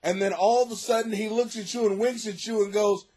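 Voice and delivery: normal talking voice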